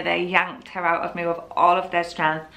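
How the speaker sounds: ordinary talk, nothing marked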